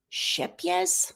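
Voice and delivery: "shep, yes" said as one name, SHEP-yes; careful brand pronunciation. Shown as a demonstration. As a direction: try out "shep, yes" slowly as a single foreign name. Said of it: "That's how I've heard you say it".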